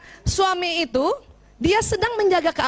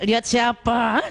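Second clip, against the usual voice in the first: mock suspicious wife pinching her husband; a lightly voiced jealous wife, lower; 4 semitones lower, slower than usual. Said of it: "Kita cubit lagi suami". (istri cemburu, nada curiga)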